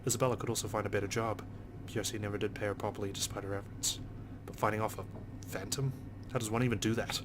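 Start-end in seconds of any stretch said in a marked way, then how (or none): none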